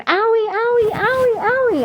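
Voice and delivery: put-on very high, loud child's wailing owie; imitating toddler's scream. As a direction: cry out like a hurting little girl. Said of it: "she would scream".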